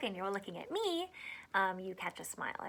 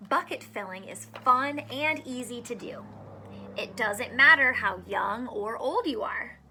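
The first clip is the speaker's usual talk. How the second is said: lively picture-book read-aloud; reading to children; 4 dB louder than usual. Acted. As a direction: read it out to a class of small kids. Reads picture book to kids.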